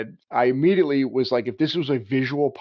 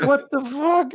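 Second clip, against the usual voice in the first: mock shocked Marines; 14 semitones higher, slower than usual. Marines react, voiced high.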